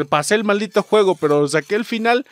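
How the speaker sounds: usual speaking voice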